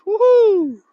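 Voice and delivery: loud, high whoop of joy; cheer. Real, not acted. Guide cheering with viewers off work, loud high whoop. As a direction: let out a big celebratory woohoo.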